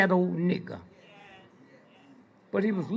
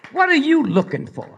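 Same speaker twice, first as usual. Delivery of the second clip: louder emphatic question; 4 semitones higher, 8 dB louder than usual. Real, not acted. Preacher repeats the challenge louder.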